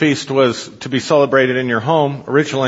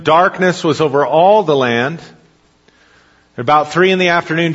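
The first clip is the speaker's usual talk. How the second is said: ordinary talk, nothing marked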